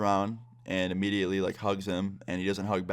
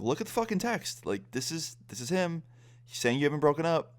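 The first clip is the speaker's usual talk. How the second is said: exasperated outburst; 8 semitones higher than usual. Retold or imitated. Showing her the proof text.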